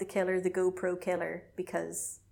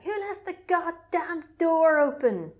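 annoyed shout; 9 semitones higher, 6 dB louder, slower than usual. Retold or imitated. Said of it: "She's like".

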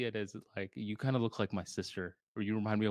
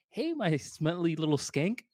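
high, slow cutesy coo; [name]'s cute voice; 7 semitones higher than usual. Retold or imitated. Husband insulting his wife in front of friends, cute voice. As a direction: sweet-talk the insult like a pet name. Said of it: "say it in a cute voice".

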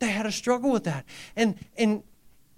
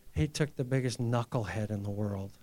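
ordinary talk, nothing marked

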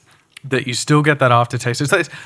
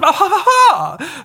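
high, hammed-up posh chuckle; mock snooty laugh mocking the whisky snobs; slower than usual. Host mocks tasting-note snobs with a hammed-up posh laugh. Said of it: "And we're like".

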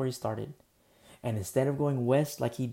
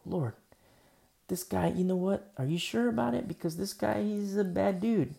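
worried Ananias; 7 semitones higher than usual. Acted. Ananias voiced, doubtful and worried.

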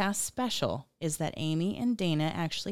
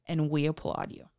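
ordinary talk, nothing marked

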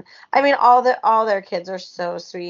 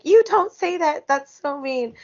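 mock scold, much higher pitch; high scolding mom; 7 semitones higher than usual. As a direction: gently scold a child in a high voice. Mom scolding her daughter.

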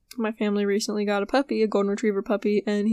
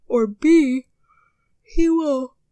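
words stretched through a real yawn, higher and louder; 4 semitones higher, 7 dB louder, much slower than usual. Real, not acted. Sleepy host to podcast listeners, yawning mid-sentence. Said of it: "oh goodness, I'm sorry. It's raining, it's making me sleepy".

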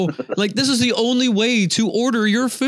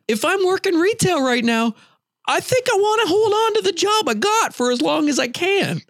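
high-pitched insistent reasoning; emphatic rant; 11 semitones higher than usual. Real, not acted. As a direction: argue the obvious with rising exasperation. Ranting about clerk's attitude toward job.

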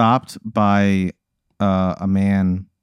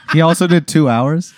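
laughing; 6 semitones higher, 4 dB louder than usual. Real, not acted. Laughing while telling.

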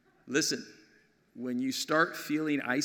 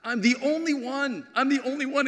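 a hint of despairing Elijah; 9 semitones higher than usual. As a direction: cry out as the last faithful man. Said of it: "what Elijah says".

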